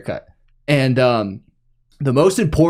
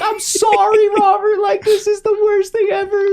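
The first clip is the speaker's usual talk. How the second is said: imitating crying mom. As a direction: play the mom apologizing through tears. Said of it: "My mom was crying".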